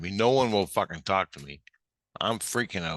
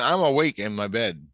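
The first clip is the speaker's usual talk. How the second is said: louder, insistent retelling; emphatic insistence; 3 semitones higher, 4 dB louder than usual. Retold or imitated. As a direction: insist on it forcefully. Insisting, then repeating it to himself.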